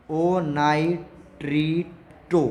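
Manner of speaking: drawn-out, deliberate naming; ओ नाइट्रिटो said very slowly, syllable by syllable; slow emphasis. Shown as a demonstration. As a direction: stretch ओ नाइट्रिटो so it sticks. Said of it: "याद रखना ये वाली बात".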